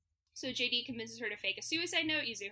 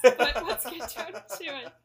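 loud, laughing through the words; real laughter; 4 semitones higher, 12 dB louder than usual. Real, not acted. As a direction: crack up while pushing on. Both laugh over conceding the debate.

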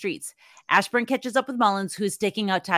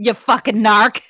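drawn-out Boston insult; 8 dB louder, slower than usual. Pretend. Quoting the film's insult.

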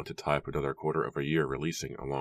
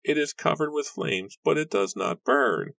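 frail woman crying out; 11 semitones higher than usual. Acted. Frail woman points villagers to the house, high cry. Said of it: "a frail woman a few feet from Nora cried".

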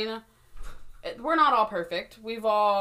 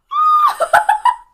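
high shrieking burst of laughter; loud shrieking laugh; 15 dB louder than usual. Real, not acted.

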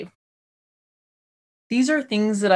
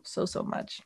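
whispered; 12 dB quieter than usual. Real, not acted. Gushing thanks to listeners.

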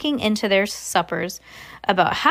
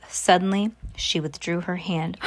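ordinary delivery

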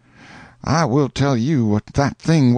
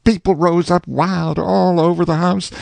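usual speaking voice

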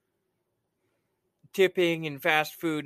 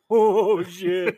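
retold realization; 3 semitones higher, 6 dB louder than usual. Recalling the late walk home, loud mock realization. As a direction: blurt it as it dawns on you. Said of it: "I was like".

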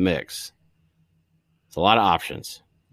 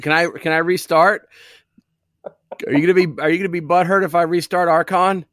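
high, raised voice to the boss; mock-earnest shouted question; calling out; 7 semitones higher, 4 dB louder than usual. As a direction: call over to the boss, pitched up and pushy.

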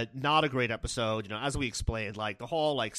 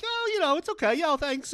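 much higher, sheepish false modesty; high mock Larry. Larry squeaking sheepishly at Kathy, high put-on voice. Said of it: "Larry's like".

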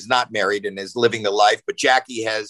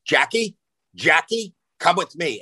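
raised-pitch retold dad summons; stern summons; 5 semitones higher than usual. Dad interrupting his daughter's test at school, retold stern summons. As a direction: act out calling your child out of class.

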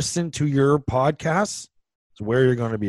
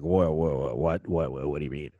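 put-on lower, fading, puzzled old parent; confused parents; 10 semitones lower, 6 dB quieter than usual. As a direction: drop it low like a baffled parent. Parents' confused reaction retold.

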